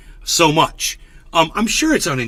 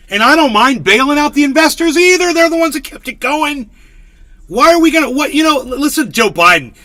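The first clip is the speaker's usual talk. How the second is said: higher and louder, worked up; heated rant; 9 semitones higher, 5 dB louder than usual. Real, not acted. Ranting to chat about bank bailouts, loud and worked up. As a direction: get fired up about the bailout.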